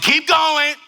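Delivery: mock mom's command; insistent mom. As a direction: urge the daughter on loudly. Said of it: "And her mom said".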